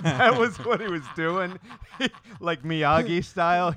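laughing through the words, pitch jumping up. Real, not acted. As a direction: crack up while saying it. Laughing at an absurd idea.

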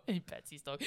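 ordinary talk

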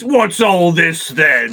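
slow, drawn-out British policeman impression; hammed-up bobby, badly faked British accent. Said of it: "Bunch of fucking bobbies".

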